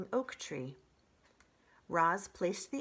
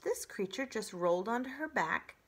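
usual speaking voice

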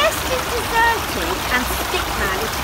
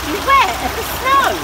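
high rising warning; high lilting warning cry; 10 semitones higher, 4 dB louder than usual. Acted. Warning call.

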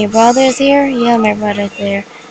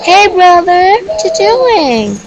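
high, loud call to brother; high teasing call; 9 semitones higher, 4 dB louder, slower than usual. Real, not acted. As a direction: shout across the room. Calling to brother.

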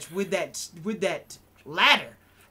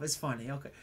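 normal talking voice

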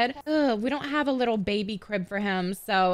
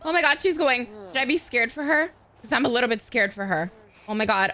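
ordinary talk, nothing marked

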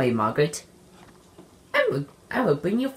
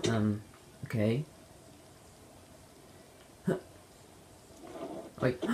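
normal talking voice

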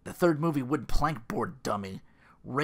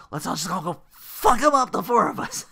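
rowdy shout; 7 semitones higher, 9 dB louder than usual. Retold or imitated. Johnny rallying the group.

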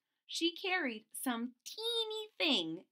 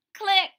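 high loud click noise; camera click sound; 9 semitones higher, 13 dB louder than usual. Pretend. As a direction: snap a click.

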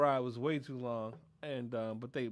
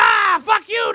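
very high, loud imitated yell; mock cursing out; 18 dB louder, slower than usual. Imitating Mulaney cursing someone out, mock furious. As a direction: imitate someone raging into a phone. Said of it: "he was cursing out somebody".

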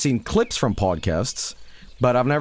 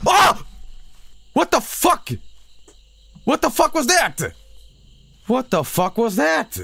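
scared shriek; 13 semitones higher than usual. Real, not acted. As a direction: shriek after a jump scare. Jump scare in game.

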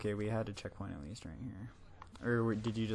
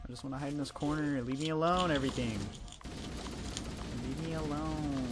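being chased; 3 semitones higher than usual. Real, not acted. Fleeing enemies in game.

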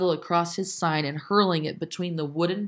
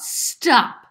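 loud, high sudden halt; called-out command; 7 semitones higher, 8 dB louder than usual. A narrator's reading. Dicey orders a passer-by to halt, loud call. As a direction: call it out sharply.

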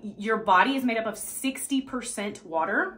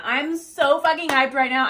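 louder, punchy hype; hyped up; 3 semitones higher, 6 dB louder than usual. Shown as a demonstration. Coach modelling affirmation number three, hyped up. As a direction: pump yourself up with it.

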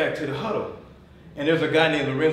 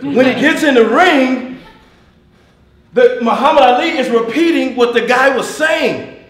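loud, high, full-force delivery; raised, driving motivational shout; fired-up preaching; 8 semitones higher, 11 dB louder than usual. Real, not acted. Preacher building to climax on leadership.

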